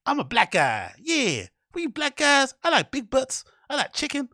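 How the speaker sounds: squeaky over-the-top parody voice. Retold or imitated. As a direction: ham it up as a mocking parody. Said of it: "that fucking voice that you guys have".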